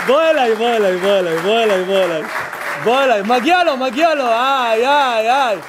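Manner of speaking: loud, emotional welcome to the man; calling out. Real, not acted. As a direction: call him up to hug him.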